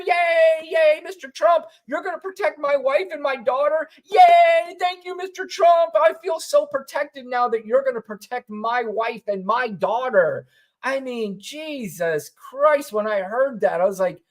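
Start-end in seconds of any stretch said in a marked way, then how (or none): 0.1-10.5 high mock fan voice, yelling yay, thanking Mister Trump; mock cheering fan. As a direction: ham up a giddy rally fan cheering. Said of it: "and the people cheer".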